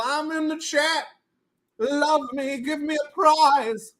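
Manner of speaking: high, pleading put-on voice; mock needy plea. Streamer begging chat in a mock plea. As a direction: beg for attention in a silly voice.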